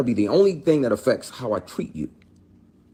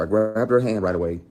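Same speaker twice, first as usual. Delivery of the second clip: usual speaking voice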